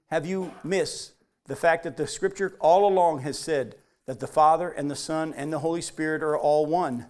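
ordinary talk

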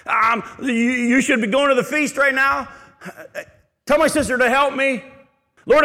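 high, loud mock complaining; whiny demanding followers. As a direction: parody needy voices badgering Jesus. Mocking demanding people to Jesus.

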